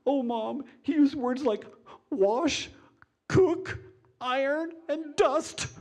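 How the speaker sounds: hammed-up weeping bride; mock crying. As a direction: wail out each word like the worst insult. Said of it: "Still crying".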